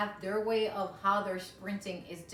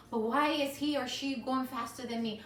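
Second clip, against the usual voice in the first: anxious inner voice; 6 semitones higher, 6 dB louder than usual. Retold or imitated. Remembered panic while running.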